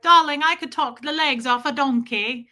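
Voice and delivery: posh British impression. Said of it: "who's like".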